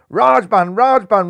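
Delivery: loud cheering chant, pitch climbing; roaring crowd. A narrator's reading. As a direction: chant his name like a stadium. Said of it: "roared the crowd".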